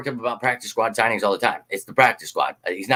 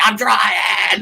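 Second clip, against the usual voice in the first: higher, mock yell of frustration; exasperated reply; 7 semitones higher than usual. Answering impatient fans, higher exasperated mock shout. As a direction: snap back that you're doing your best. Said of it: "And I'm like".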